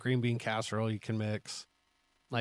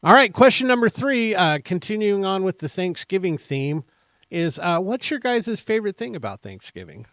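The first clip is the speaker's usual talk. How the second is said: loud, high show-host read; host announcer; 8 semitones higher, 9 dB louder than usual. Acted. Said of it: "host announcing next question".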